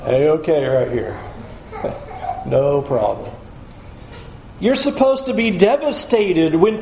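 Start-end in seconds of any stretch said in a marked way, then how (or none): 0.1-3.3 smug relief. Retold or imitated. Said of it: "look at the law and go".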